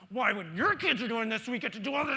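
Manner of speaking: petulant mock outrage, high and loud; sarcastic retort. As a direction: sneer the question back at the boss. Sarcastic reply to the boss's text.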